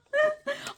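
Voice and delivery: squeaky burst of laughter; high giggle. Real, not acted. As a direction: giggle at yourself. Laughing at own impression.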